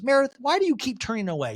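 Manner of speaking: high-pitched pleading complaint; Angie K impression. Castmate chasing another who turns away, mock indignant. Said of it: "And Angie K goes".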